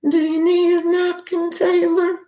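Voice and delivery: put-on very high, frail old Texas grandma voice; grandmother impression. Offering a napkin, impersonating his grandmother in a very high frail voice. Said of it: "this will be my best impression of her".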